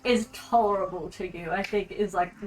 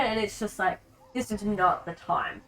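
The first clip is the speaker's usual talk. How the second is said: usual speaking voice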